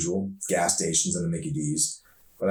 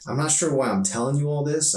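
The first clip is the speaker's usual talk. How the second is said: put-on higher, slightly embarrassed girl; half apologize, pitched up; sheepish teen girl; 7 semitones higher than usual. Emery confides in Arson, lightly voiced sheepish girl.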